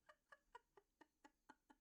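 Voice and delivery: high airy breathless laugh. Real, not acted. Streamer cracking up over her cringy time lapses, breathless laugh. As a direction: silent wheezing laugh.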